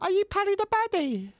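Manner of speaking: very high, loud mock fan squeal; lady at the door. Lady recognises the fighter on her doorstep, high-pitched mimicry. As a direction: squeak it out as the starstruck neighbour. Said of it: "And she's like".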